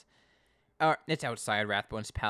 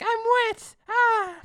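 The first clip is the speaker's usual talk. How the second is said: much higher, loud squealing shriek; mock shriek; 10 dB louder, much slower than usual. Connie sprayed by the hose, shrieking high and loud. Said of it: "she's like".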